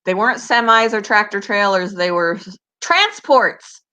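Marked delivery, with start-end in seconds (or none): 2.8-3.8 transports said high with relief; finally recalling the word, pitched up; remembering. Real, not acted. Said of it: "The Canadian is going out of me".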